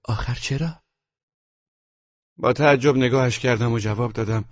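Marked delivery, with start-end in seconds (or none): 0.0-0.8 softly worried question; a touch of anxiety. A narrator's reading. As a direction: ask with quiet concern, softer than usual. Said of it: "با نگرانی پرسید".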